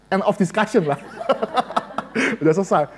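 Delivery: words broken by real laughter; amused, giggling wrap-up; laughing. Real, not acted. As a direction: laugh as you close the topic. Laughing at own joke.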